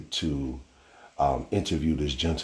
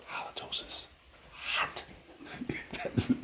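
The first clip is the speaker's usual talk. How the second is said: whisper; 11 dB quieter than usual. Real, not acted. Explaining bad breath to listeners in a whisper. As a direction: whisper the word Halitosis.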